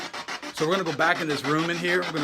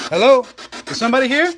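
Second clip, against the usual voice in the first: loud, high call into the dark; startled call; 7 semitones higher, 5 dB louder, faster than usual. Real, not acted. Calling out after hearing a lady's voice, loud and startled.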